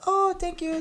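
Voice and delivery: high, sweet imitation of a flattered girl; girly thank you. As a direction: coo it like a charmed young woman. Girl answering the compliment.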